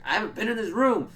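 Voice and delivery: high, loud mock protest; retold defensive outcry; indignant denial. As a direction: protest innocence, acting it out. Acting out denial of the accusation.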